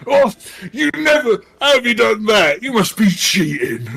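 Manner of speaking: high, loud outraged NPC; an NPC cries foul at the table; indignant accusation. Acted. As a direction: shout that they must be cheating. NPC accuses player of cheating.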